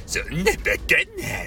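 exaggerated actor impression, vowels distorted; comically put-on veteran actor voice. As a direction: do a hammed-up celebrity impersonation. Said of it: "たまにこんな声も出すよ".

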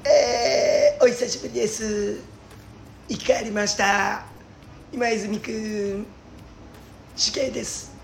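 high, drawn-out detective impression; slow, stretched phrases pitched well up, with long pauses; Furuhata Ninzaburo. Shown as a demonstration. Comedian doing an impression on request for his partner, drawling TV detective. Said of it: "まあ、古畑任三郎とか。".

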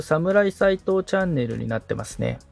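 usual speaking voice

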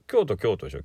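ordinary talk

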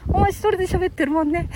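high-pitched puppet jibe; cheeky high character voice; teasing puppet. Pretend. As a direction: needle him as the little dummy, pitched high. Puppet needles Grandpa.

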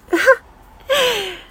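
loud high laugh, trailing off; sudden high burst of laughter; real laugh. Real, not acted. Forgot another title.

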